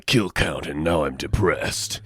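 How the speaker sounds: low, slow mock brooding soldier; gloomy killer clone, slowly; edgy soldier voice. Imitating Sev character.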